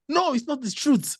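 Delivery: normal talking voice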